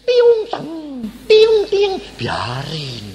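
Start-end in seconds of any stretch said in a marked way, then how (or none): 0.1-1.9 high, loud bouncing sounds; ricochet noises. Shown as a demonstration. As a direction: make the insults bounce off like bullets.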